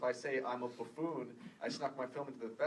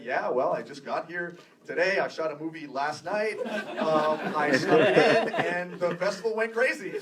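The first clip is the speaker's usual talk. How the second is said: a touch of a louder, excited in-character brag; lightly put-on festival braggart, getting louder; 4 semitones higher, 10 dB louder than usual. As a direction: tell the panel your tall tale, building in volume. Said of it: "I was in character".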